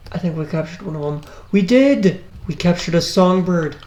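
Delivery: ordinary talk, nothing marked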